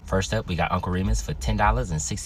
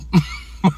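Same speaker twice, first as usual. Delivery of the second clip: laugh; 12 semitones higher, 7 dB louder than usual. Real, not acted. Joking about a gun fight.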